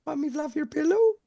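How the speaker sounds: very high-pitched put-on voice; housekeeper bit. Joking along after the cleaning lady remark, put-on housekeeper voice. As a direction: squeak it out as a mock housekeeper.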